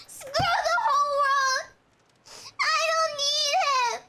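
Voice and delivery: high, loud crying yell. Acted. Jilted man yelling alone in the wrecked flat. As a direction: scream it out in rage. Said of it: "He yells".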